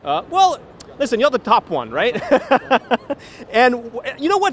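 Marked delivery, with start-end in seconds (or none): 2.2-3.5 laughing. Real, not acted. Laughing at interview banter.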